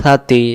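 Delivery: light air over the tongue tip starting সাতি; tongue-tip articulation. Shown as a demonstration. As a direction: say সাতি like "thaa-tee", th as in "think". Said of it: "জিহ্বার আগা থেকে".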